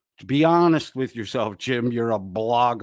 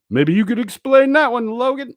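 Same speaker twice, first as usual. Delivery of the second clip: mocking high voice; 13 semitones higher, 6 dB louder than usual. Mocking question to Logan.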